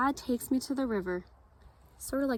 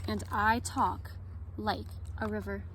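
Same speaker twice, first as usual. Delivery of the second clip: gentle read-aloud storytelling; story narration. A narrator's reading. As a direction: read it like story time for kids. Reads picture book at story time.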